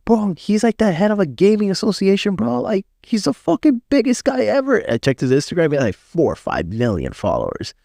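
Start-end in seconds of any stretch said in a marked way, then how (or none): 0.1-4.8 high-pitched hyped-up reveal; excited retelling, voice climbing high; acted awed excitement. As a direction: imitate a friend gushing about a big shot. Someone reveals a big shot's identity.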